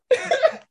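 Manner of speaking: big squealing laugh; loud high laugh. Real, not acted. Laughing at the Jordan impression.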